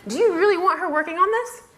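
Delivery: high, insistent disbelieving questions; incredulous outrage. Retold or imitated. Stunned blogger questioning who at Federated slipped up, incredulous. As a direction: demand answers, pitch pushed way up. Her stunned indignant reaction retold.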